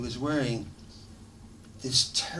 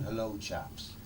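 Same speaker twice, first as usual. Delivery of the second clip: mock British toff; 3 semitones lower, 7 dB quieter than usual. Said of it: "what the fuck are you doing".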